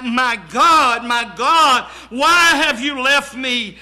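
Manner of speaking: loud preached imitation of a cry. Preacher voicing Jesus calling to his Father, loud cry. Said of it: "He cried out to his Father".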